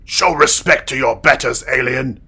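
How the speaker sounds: angry bark. Acted. Guard strikes prisoner, barks.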